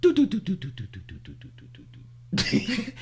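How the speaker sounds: repeated do-do jingle, fading away; vocal echo effect starting high and dying out; made-up sound effect. Pretend. Host making up the segment's sound effect, fading do-dos. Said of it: "I'm gonna make 'em up".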